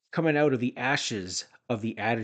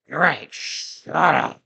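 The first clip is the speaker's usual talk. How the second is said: slow, loud mock blowhard; mock Vince; 4 semitones higher, 6 dB louder, much slower than usual. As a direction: drag it out like a pompous boss. Mocking Vince in recap.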